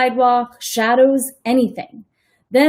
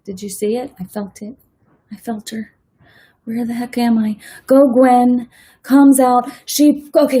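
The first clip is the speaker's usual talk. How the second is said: ordinary delivery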